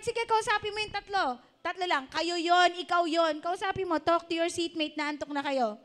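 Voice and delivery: energetic call to the congregation; rousing exhortation. Real, not acted. Rallying congregation to talk to seatmates.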